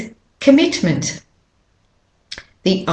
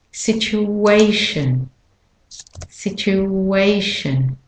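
situation slowly, lengthened WAY; stress on WAY; 2 semitones higher, slower than usual. Shown as a demonstration. Teacher demonstrating main stress, slow WAY in situation. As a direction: draw out the third syllable of situation. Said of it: "And the main stressed syllable is".

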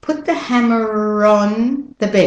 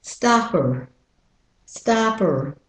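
American "stopper" like "stahp-er"; American-accent word demo; slower than usual. Shown as a demonstration. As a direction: open O and final R in American "stopper". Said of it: "And with the American accent".

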